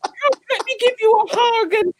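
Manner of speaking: high, laughing, cooing voice; mock doting mummy. Dad mocking how mums fuss over a fallen child, high and cooing. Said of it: "mummies might be like".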